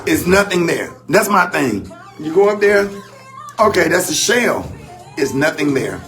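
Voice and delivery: ordinary talk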